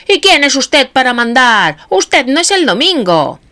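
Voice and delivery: high, loud heckling shout; angry shout. A narrator's reading. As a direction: yell him down. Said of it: "gritó un anarquista". (grito airado)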